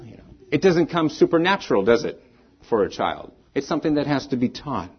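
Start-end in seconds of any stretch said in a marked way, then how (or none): none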